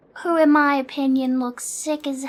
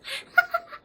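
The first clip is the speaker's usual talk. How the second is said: high giggle; 4 dB quieter than usual. Real, not acted. Laughing after remark.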